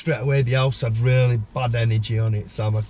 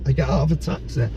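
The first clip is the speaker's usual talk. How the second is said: ordinary talk, nothing marked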